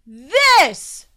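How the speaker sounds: loud triumphant shout. Acted. Gloom to his brother, revealing the party with a shout. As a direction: shout it out to spring the surprise. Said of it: "shouted Gloom".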